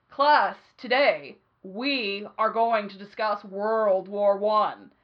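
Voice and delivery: mock-grand start of class; grand teacher announcement. History teacher opening class, slow and announcing. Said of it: "and he'd be like".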